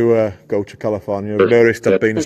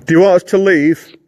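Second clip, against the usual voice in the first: louder, higher call into the dark; talking to a ghost; 5 semitones higher, 5 dB louder than usual. Real, not acted. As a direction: call out to an unseen spirit. Calling out to the spirit.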